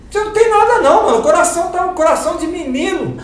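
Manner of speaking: high, loud, cheery news; cheerful doctor. Retold or imitated. Doctor telling the pastor his heart is fine, high and loud.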